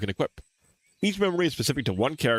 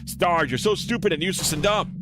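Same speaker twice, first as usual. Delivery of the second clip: higher-pitched mock scream, held back; restrained self-scolding scream; 7 semitones higher than usual. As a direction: berate yourself in a contained outburst. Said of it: "put your hand over your mouth and scream".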